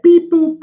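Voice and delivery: high squeaky electronic beeps; computer beeps. Retold or imitated. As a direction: chirp like an old game tune. Imitating old computer game sounds.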